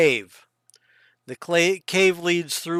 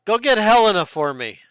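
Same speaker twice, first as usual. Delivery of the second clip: louder, higher chief's command; chief giving orders; 6 semitones higher, 6 dB louder than usual. Acted. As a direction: send an underling off as the chief. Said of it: "And he goes".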